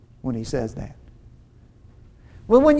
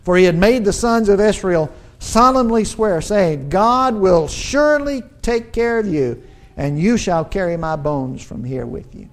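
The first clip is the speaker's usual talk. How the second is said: scripture reading; 3 semitones higher, 5 dB louder than usual. Acted. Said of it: "look in verse nineteen".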